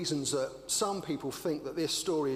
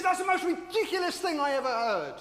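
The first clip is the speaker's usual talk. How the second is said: much higher, louder, ranting shout; fiery preacher rant; 9 dB louder than usual. Retold or imitated. Baptist preacher yelling at Edna in hammed-up rage. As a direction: bellow it like a fire-and-brimstone preacher. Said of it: "fiery Baptist preacher".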